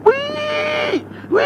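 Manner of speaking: piercing high squeal of a wounded deer. Retold or imitated. Trucker imitates the hit deer screaming at him, piercing squeal. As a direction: shriek it like a hurt animal. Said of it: "sitting up there squealing".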